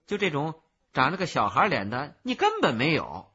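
loud, animated challenge; scoffing disbelief. A narrator's reading. Peddler doubts the child's boast, scornful and loud.

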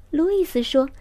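ordinary delivery, nothing marked

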